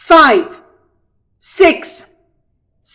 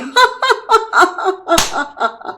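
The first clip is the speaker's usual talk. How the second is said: high teasing laugh; mocking giggle; 8 semitones higher, 5 dB quieter than usual. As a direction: laugh off the trick. Rabbit laughs.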